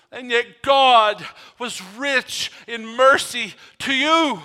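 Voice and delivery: full preaching. Acted. Pastor urging the congregation, full preaching force. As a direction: build it up and press it home.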